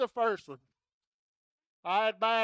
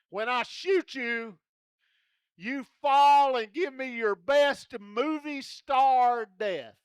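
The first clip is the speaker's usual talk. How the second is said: normal talking voice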